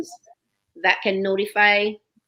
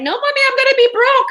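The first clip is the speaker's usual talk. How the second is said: put-on much higher, loud wailing child; whiny little girl; 9 dB louder, faster than usual. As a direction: wail it like a dismayed kid. Daughter voiced whining as a child.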